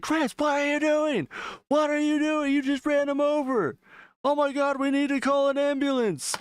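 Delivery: very high, mock-hysterical dad; retold horrified dad. Dad to son who ran a man over, mock horror. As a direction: act out a panicking father.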